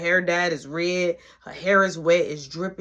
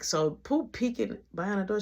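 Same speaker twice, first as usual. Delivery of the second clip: usual speaking voice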